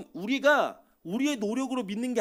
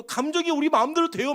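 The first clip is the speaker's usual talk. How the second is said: higher-pitched mock tearful plea; imitated tearful protest; 7 semitones higher, 4 dB louder than usual. Young lover defending an unsuitable match, mock tearful protest. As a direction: protest as if crying, lightly acted. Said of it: "막 이러면서 울어요".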